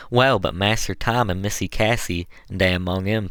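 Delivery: usual speaking voice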